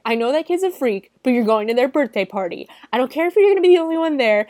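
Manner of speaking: loud, high, fast mock scolding; insistent mom. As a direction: lay down the law as a pushy mom. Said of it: "gonna be that mom".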